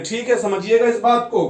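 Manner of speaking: ordinary talk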